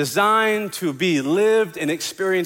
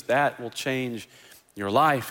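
ordinary talk, nothing marked

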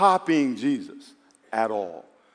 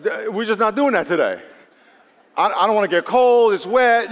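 reluctant talking glove; 3 semitones higher, 5 dB louder than usual. Acted. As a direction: playfully act out a glove that refuses to cooperate. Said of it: "the glove says".

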